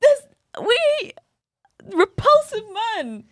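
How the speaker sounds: building from firm to a high, loud mock shriek; mock outburst. Friend voicing the film heroine, rising to a mock disgusted shriek. Mocking the heroine's resigned thoughts.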